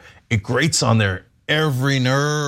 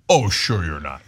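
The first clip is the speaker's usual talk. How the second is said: low, dry sarcastic agreement; 8 semitones lower than usual. Retold or imitated. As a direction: pretend to agree, with obvious disbelief. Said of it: "Without the sarcasm".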